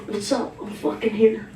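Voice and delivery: lightly voiced cocky date. Comic imitating her date arriving, a touch of low bro. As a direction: mumble it like a guy too cool to open doors. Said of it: "just to be like".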